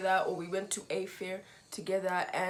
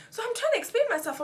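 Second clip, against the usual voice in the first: heated retelling; 14 semitones higher, 6 dB louder, faster than usual. Real, not acted. Excited retelling, voice shoots up.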